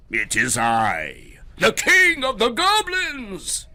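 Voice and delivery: slow, booming roar rising high; fearsome goblin king. Acted. Goblin king announcing himself to Herschel, booming. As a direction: boom it out like a monster. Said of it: "A fearsome voice spoke".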